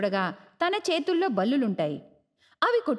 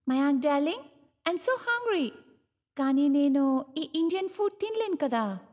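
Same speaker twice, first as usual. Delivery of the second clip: foreign daughter-in-law; 2 semitones higher than usual. Acted. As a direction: coo it at your husband, pitched up. Ena whines to husband.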